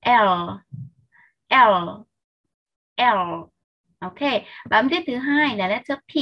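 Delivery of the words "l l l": L with tongue tip touching the upper palate; separate, held L sounds; curled-tongue L. Shown as a demonstration. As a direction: curl the tongue up for each L. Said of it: "cong lưỡi của mình và đầu lưỡi chạm lên hàm trên".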